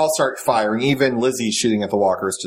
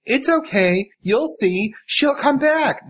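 put-on much higher, eerily sweet girl; creepy child voice; 12 semitones higher, slower than usual. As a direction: soothe like a disturbed little girl.

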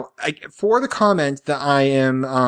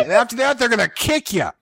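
higher, hammed-up gangster warnings; mock New York thug; 6 semitones higher than usual. Husband mimicking Jen's list of dangers, mock thug voice. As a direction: list the dangers like a mafia heavy. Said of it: "Jen has turned into, like, thug".

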